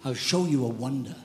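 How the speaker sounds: low, soft, intimate sermon voice; quiet heartfelt preaching; hushed preaching. Acted. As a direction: draw the church in close, softly.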